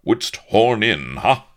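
loud, high indignant challenge; dark displeasure. A narrator's reading. Avery objects to Blood horning in, loud indignation. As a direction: rebuke the intruder haughtily. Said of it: "Avery's brow was dark".

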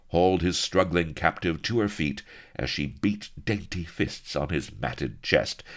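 ordinary delivery, nothing marked

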